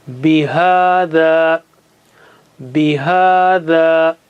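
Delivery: "bihada" with both long a's stretched equally; stretched vowels. Shown as a demonstration. Teacher demonstrating for students, "bihada" stretched slowly. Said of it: "You got to stretch them equally".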